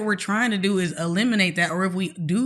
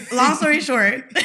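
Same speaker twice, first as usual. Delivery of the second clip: laughing; 5 semitones higher, slower than usual. Real, not acted. Laughing off the story.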